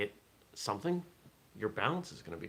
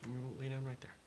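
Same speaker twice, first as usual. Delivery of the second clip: talking to a pet; 6 semitones higher, 4 dB quieter than usual. Real, not acted. Coaxing a pet to lie down.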